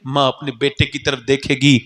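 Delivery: ordinary delivery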